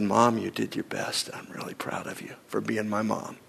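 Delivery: soft whisper, choked with tears; tearful whisper. Retold or imitated. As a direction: whisper it to mom through tears. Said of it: "he just started to weep".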